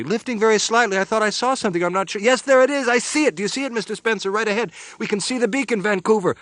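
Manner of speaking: high, loud and breathless with excitement; excited. A narrator's reading. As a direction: spot the beacon, thrilled. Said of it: "She was saying excitedly".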